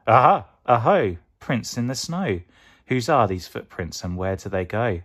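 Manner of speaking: loud, high triumphant "Aha! Aho!"; turning the child's own cry back at her; mouse echoing the Aha. Acted. Mouse mocks the Gruffalo's child, loud and gleeful.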